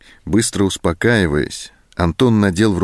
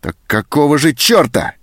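higher, louder enraged snap; furious outburst; 8 semitones higher, 4 dB louder than usual. A narrator's reading. Sergeant rages at Zoska, furious and loud. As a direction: explode with rage. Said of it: "взъярился сержант".

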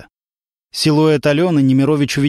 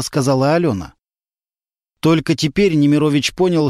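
ordinary delivery

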